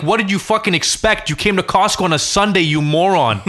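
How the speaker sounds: retold yell. Yelling at honking man.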